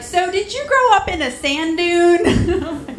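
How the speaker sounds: slow, high, loud mock-clueless question; clueless classmate. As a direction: drawl it out like a naive kid. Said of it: "they'd be like".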